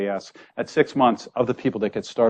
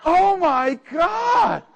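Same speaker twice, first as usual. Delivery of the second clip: much higher, very slow laughing cry; laughing exclamation; 4 dB louder, much slower than usual. Real, not acted. Speaker cries out in laughing disbelief at the surgeon. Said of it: "reacting to the story".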